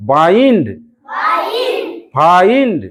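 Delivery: বাইন্ড, ফাইন্ড stretched as "baa-eend, faa-eend"; long আই sound. Shown as a demonstration. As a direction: swell up on the "ee" in বাইন্ড, ফাইন্ড, then drop. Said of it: "ওইরকম উচ্চারণ কর".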